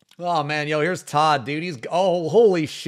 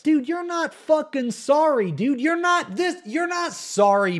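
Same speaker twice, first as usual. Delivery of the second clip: outraged yell; 3 dB louder than usual. Real, not acted. Outraged at a fake apology.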